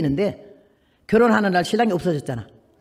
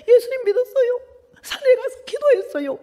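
very high, half sobbing, half laughing; laughing through tears. Retold or imitated. Patient tearfully telling the nun she prayed, sobbing high. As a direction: crack into tears while telling it.

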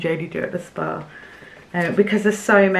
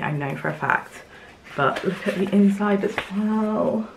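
normal talking voice

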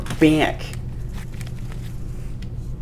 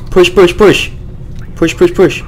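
urgent shouting; 6 semitones higher, 3 dB louder than usual. Real, not acted. Urging team forward.

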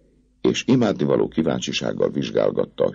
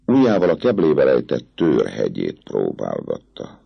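ordinary delivery

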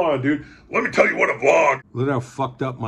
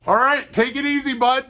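much higher, chirpy Canadian send-off; friendly Canadian goodbye, pitched way up; hammed-up Canadian farewell; 9 semitones higher than usual. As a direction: sing it out like a friendly Canuck.

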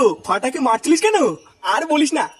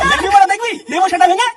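loud, higher-pitched father's tirade; angry threat; 4 semitones higher, 4 dB louder than usual. Acted. Father threatens to beat back-talking son, angry shouting. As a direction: threaten the boy at full temper.

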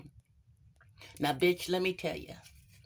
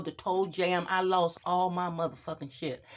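ordinary delivery

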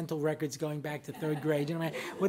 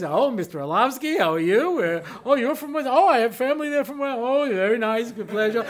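loud, effusive small talk; imitating chummy English-speaking officials; consulate pleasantries; 5 semitones higher, 6 dB louder than usual. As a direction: gush politely, as at a diplomatic reception. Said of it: "everybody spoke English".

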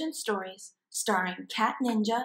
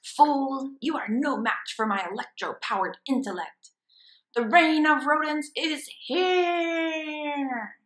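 triumphant villain hamster; 5 semitones higher, 5 dB louder than usual. Acted. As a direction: proclaim the reign of rodents.

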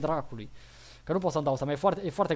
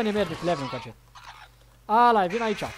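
ordinary talk, nothing marked

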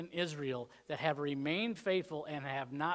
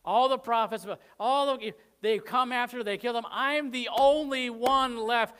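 mock-whining prophet, louder and higher as it builds; whining Elijah; 4 semitones higher, 6 dB louder than usual. Preacher voices Elijah whining to God, louder and higher. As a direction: whine like a self-pitying prophet complaining to God. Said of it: "He's like".

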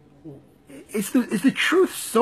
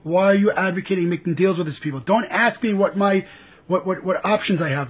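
ordinary talk

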